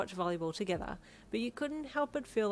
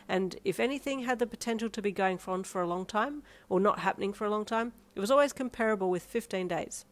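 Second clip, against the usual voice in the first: plain reading voice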